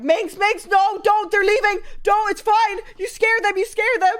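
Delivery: high, loud mock pleading calls; retold frantic calls. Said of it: "two voices behind me".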